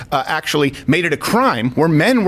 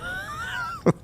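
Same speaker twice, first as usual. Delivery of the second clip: high shouted laugh; 12 dB quieter than usual. Real, not acted. Mocking laugh after rant.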